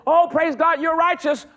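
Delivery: hammed-up high, loud TV preacher; mock the TV preacher's gushing praise. Mocking a television preacher who mixes the covenants, hammed-up. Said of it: "you hear somebody mixing it".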